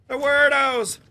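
high, indignant exclaim. Acted. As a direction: toss it off high and scornful. Calling enemies weirdos.

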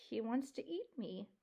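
small, soft frightened voice; faint. Acted. Mouse admitting the fox's threat, faintly. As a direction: say it faintly, barely there. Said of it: "Poppy said faintly".

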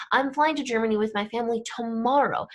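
"tomorrow" accented at the end, the rest quick; stress on tomorrow. Shown as a demonstration. Teacher demonstrating emphasis in English, stress on "tomorrow". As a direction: hold back the emphasis until "tomorrow". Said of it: "we would use our voice".

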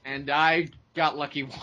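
usual speaking voice

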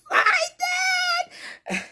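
shrill squeal of laughter; high shrieking laugh. Real, not acted.

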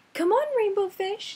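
high bright calling voice. Acted. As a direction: call out to him playfully. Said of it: "they would call".

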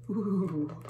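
plain reading voice

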